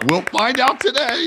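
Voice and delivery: high, loud, excited shout; loud excited prediction, voice leaping up; hyped-up cheer. Real, not acted. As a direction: pump it up like a rallying cry. Hyped-up repeat of his prediction.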